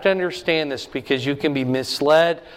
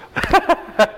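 a sudden high laugh at the punchline; real high laugh after the joke; high burst of laughter; 12 semitones higher, 3 dB louder than usual. Real, not acted. Laughs at own story.

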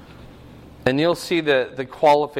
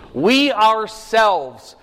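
"We" drawn out high, slow "ourselves"; emphatic stress on We; 5 semitones higher, 4 dB louder, much slower than usual. Shown as a demonstration. Preacher explaining who made the sacrifice, emphatic stress on We. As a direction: stretch and lift "We" to stress who. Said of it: "It's an emphatic".

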